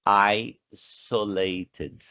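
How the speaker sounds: isolated drawn out slowly, S kept as a real S; slow pronunciation demo. Shown as a demonstration. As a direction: say isolated slowly for the class, clear S. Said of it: "Não, esqueça isso".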